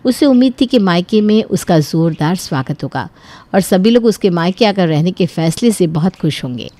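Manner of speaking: ordinary delivery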